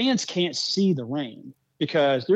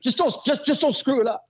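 much higher, half-laughing mock mentor; mentor's blunt warning; 11 semitones higher than usual. Mentor telling the new boss not to screw it up, high and laughing. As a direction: deliver the blunt punchline with a chuckle. Mentor's punchline, voiced in story.

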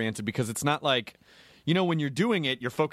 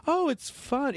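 breezy actor; 12 semitones higher, slower than usual. Retold or imitated.

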